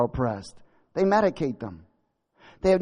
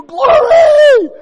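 shouted praise; 18 dB louder than usual. Real, not acted. Shouted praise mid-verse.

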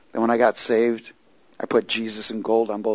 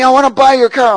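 imitating a loud, high holler; retold yell; 14 semitones higher, 11 dB louder than usual. Big man yelling his offer to the preacher, imitated yell. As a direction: shout it as the insistent stranger. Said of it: "he starts yelling to me".

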